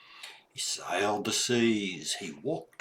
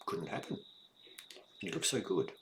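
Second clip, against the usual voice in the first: whispered aside; 2 semitones lower, 6 dB quieter than usual. A narrator's reading.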